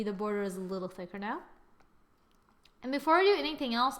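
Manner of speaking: ordinary delivery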